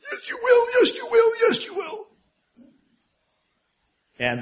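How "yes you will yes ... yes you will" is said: put-on high, loud, shrill-pitched yell at a child; parent yelling back. Parent losing self-control, yelling back at screaming toddler. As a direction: shout the child down, pitch soaring. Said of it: "tempted to raise your voice and say".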